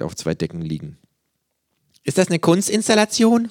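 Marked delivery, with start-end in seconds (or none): 2.0-3.5 high, loud mock whisper; squeaky-high kangaroo, hardly hushed at all; kangaroo's stage whisper. A narrator's reading. Kangaroo to patient in darkened room, high loud stage whisper. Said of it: "flüstert das Känguru".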